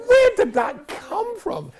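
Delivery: loud, drawn-out, high-pitched disbelief; hammed-up appalled question; acted horrified shock. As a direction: stretch it out aghast, way up high. Acts shock at intrusive thought.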